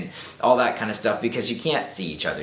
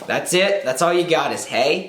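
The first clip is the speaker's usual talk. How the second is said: mocking; 8 semitones higher, 4 dB louder than usual. Mocking a lazy opener.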